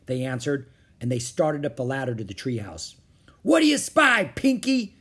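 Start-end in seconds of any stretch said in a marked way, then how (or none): 3.4-5.0 high, loud captain's call; shouting pirate. A narrator's reading. Captain Bones to Pinky in the treehouse, shouted. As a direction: yell up to a crewman. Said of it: "shouted Captain Bones".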